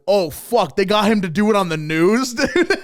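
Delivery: amazed they pulled it off; mock shocked. Viewers seeing the death on the news, excited. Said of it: "they'll be like".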